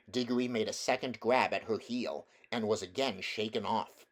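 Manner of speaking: usual speaking voice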